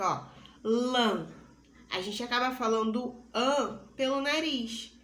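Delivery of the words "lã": "Lã" said louder, with the vowel pushed through the nose; nasal vowel demo. Shown as a demonstration. Teacher says "Lã" aloud to show the nasal vowel. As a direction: hold the nasal "ã" in "Lã" so it rings. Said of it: "Fale em voz alta e perceba essa característica".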